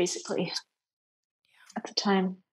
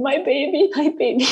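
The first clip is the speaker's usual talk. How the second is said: a mild mock wail, higher, no louder; retold wail; 6 semitones higher, 4 dB louder than usual. Mother recalling the scan room, mildly voiced wailing. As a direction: echo the screaming without full volume. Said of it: "I was just screaming".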